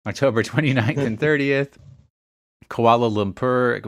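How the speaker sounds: a laugh breaking into the date; amused while reading the list; laughing mid-list. Real, not acted.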